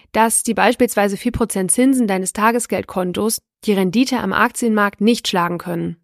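ordinary delivery

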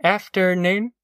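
politely questioning hello; mother's greeting. A narrator's reading. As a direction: return the greeting with a questioning lift. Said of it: "said Ma".

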